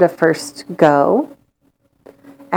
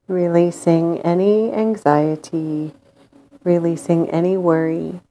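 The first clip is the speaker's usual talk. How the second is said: guided meditation. Acted. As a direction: lull them into calm with long pauses.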